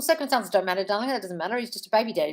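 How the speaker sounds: normal talking voice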